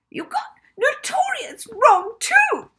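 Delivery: very high, slow, squealing teacher; very high squeaky voice, sneering. A narrator's reading. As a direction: squeak it out like a shrill spiteful teacher. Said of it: "came her squeaky voice".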